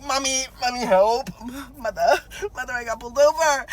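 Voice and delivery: high, hammed-up whimper for help; mock tearful plea. Driver texting mom after being pulled over, mock tearful. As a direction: cry out to mother in pretend distress.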